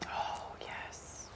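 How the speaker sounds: hushed breathy whisper. Real, not acted. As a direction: whisper it like a delicious secret. Savoring the food, breathy whisper.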